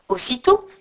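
ordinary delivery

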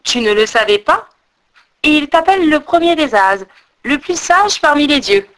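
mocking. A narrator's reading. Squirrel making fun of Odin, mocking. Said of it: "Il se moqua de lui".

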